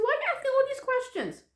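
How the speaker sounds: very high, quick imitation of mom; put-on high, impatient mother; exasperated mother. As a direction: complain about the fuss in a high voice. Mother objects to the questions.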